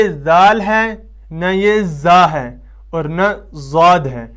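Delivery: ذال, زا and زاد each said slowly and loud; contrasting letters. Shown as a demonstration. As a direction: set out ذال, زا, زاد one by one. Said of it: "یہ ان تینوں سے الگ ہے".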